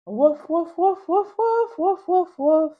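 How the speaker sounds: high loud string of woofs; puppy barking. Acted. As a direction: bark insistently like a little dog. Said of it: "I say".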